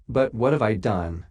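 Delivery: ordinary delivery, nothing marked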